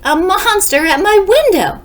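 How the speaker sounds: high, loud terrified cry; frightened shout. Acted. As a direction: panic at the window. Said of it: "shouted Baby".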